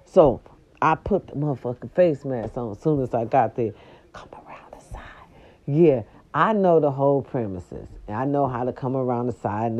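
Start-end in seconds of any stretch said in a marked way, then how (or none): 4.1-5.0 hushed whisper. Retold or imitated. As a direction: whisper it like you're sneaking round a house. Whispered instruction while sneaking.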